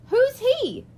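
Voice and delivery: put-on high puzzled child's voice; curious kid. A narrator's reading. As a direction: ask like a puzzled little boy. Kid asking.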